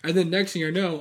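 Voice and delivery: usual speaking voice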